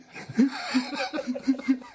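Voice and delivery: high burst of laughter. Real, not acted.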